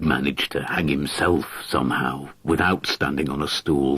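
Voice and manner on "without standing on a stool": usual speaking voice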